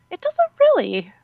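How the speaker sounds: high, mildly indignant retort; retold protest. Traveler answering the agent who scoffs at her pay, high protest.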